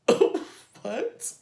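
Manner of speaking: high squeaky what; shocked what. Retold or imitated. Stunned at the guy on top of her, squeaky what. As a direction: blurt it out in disbelief.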